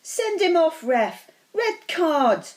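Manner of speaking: indignant supporter's high yell. A narrator's reading. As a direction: shout at the referee from the stands. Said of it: "Dean Thomas was yelling".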